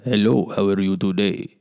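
a light put-on Russian-Italian accent; vaguely foreign Russian accent, lightly done. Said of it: "that's kinda like my Russian, Italian".

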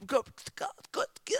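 choppy broken-up syllables; phone cutting out. Retold or imitated. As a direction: imitate a call dropping in and out. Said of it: "the phone is not working".

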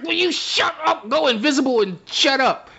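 high, annoyed and urgent; exasperated master. Retold or imitated. Master tells Futon to go invisible, high and exasperated. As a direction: snap it out in a hurry. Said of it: "And he's like".

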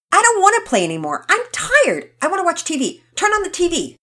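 put-on high, loud, fast child demands; demanding child. Rocket demands the TV from the other kids, whiny. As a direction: insist like a spoiled kid. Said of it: "Rocket began to demand".

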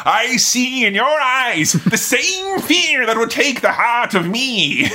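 high, slow, hammed-up Irish battle speech; Aragorn, Irish accent. Aragorn rallying for battle, grand Irish accent. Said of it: "Irish Aragorn".

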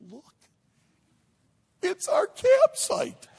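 high, loud, slow amazed announcement; can't believe it, show him; wife showing husband the postcard, high and amazed; amazed exclaim. Retold or imitated.

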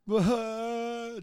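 a high sob breaking out; sudden sob. Real, not acted. Overwhelmed man at the altar, high sudden sob. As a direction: let a real sob burst out high. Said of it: "started crying uncontrollably".

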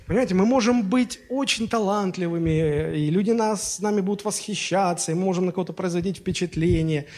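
ordinary talk

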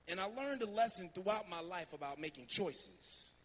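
usual speaking voice

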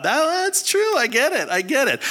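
high, amused giving in; amused concession. Retold or imitated. Pastor giving in to the couple's joke, high and amused. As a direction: concede with a laugh in the voice.

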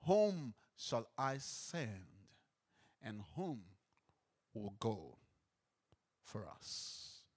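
a deep, distant call from above; God calling, low and hushed; voice of the Lord. A narrator's reading. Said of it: "heard the voice of the Lord saying".

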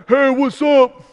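lightly voiced laid-back God; a hint of a chill, friendly God; casual God voice. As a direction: toss it off like God greeting a buddy. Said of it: "I don't know why I'm talking like that".

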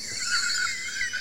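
sudden high laugh bursting out; burst of laughter. Real, not acted. Podcast host laughing at his own mock quote, sudden high laugh. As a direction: let a real laugh break out at your own joke.

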